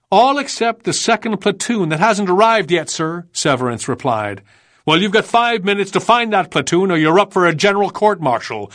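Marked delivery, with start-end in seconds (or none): 0.1-3.3 raised, higher-pitched report to an officer; reporting to superior. A narrator's reading. Captain telling the colonel a platoon is missing, urgent report. 4.9-8.7 higher-pitched officer's threat; sharp retort. A narrator's reading. Colonel threatening his captain with court-martial, sharp retort. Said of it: "the colonel retorted".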